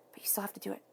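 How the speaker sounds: hushed, intimate nudge; whispered aside. Real, not acted. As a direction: drop to a soft whisper. Quiet aside of encouragement.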